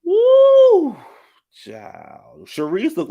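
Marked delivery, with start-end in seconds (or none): 0.0-1.0 loud high whoop; loud high-pitched woo. Real, not acted. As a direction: let out a big high woo. Shocked reaction to the look.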